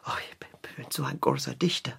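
fast, whispered self-praise; whispered aside. Acted. Will whispers his own praise to John. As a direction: whisper it quickly to yourself.

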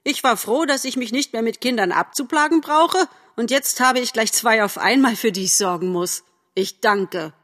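put-on high, lively older-relative fussing; mock-complain cheerfully about the extra burden; cheerful grumbling. A narrator's reading. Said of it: "sagte Frau Nettler munter". (munter schimpfend)

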